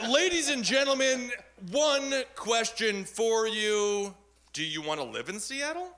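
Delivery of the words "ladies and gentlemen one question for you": mock orator. Debater addressing the crowd.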